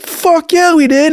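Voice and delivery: put-on very high, loud drunken affirmation; emphatic yell. Drunk man answers the cop about deployment, high defiant yell. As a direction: shout it back with full conviction.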